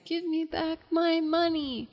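put-on high, slow child's plea; a hint of the boy in the bank demanding his pennies back; pleading kid. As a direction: whine it like a little boy who wants his money back. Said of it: "they hear the kid saying".

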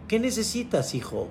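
very soft, hushed fatherly voice; God's gentle voice. Acted. God asks the climber his need, softly.

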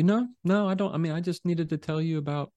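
high, quick defensive denial; defensive backpedal. Retold or imitated. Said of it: "typically they'll say".

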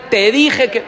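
loud scolding told-you-so; reproachful. Pretend. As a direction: rub it in loudly. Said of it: "No, no te dice eso".

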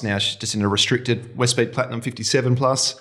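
usual speaking voice

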